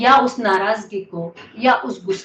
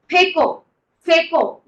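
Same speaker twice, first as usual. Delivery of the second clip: raised, forceful coaching call; urging command; 5 semitones higher, 6 dB louder than usual. Real, not acted. Teacher urging the class to throw it out, forceful call. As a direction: drive the group with a louder, higher push.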